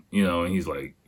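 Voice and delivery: ordinary talk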